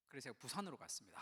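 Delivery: normal talking voice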